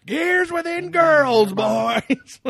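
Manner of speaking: very high, slow, loud squeaky voice; high silly voice. Pretend. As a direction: squeal it out in a cartoon falsetto.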